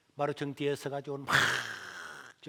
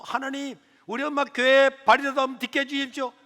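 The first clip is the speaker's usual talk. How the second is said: desperate prayer; 7 semitones higher, 8 dB louder than usual. Retold or imitated.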